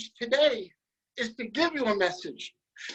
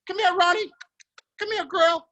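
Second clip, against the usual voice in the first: calling the dog; 7 semitones higher than usual. Pretend. Host calling his dog in a pretend river scene, raised call.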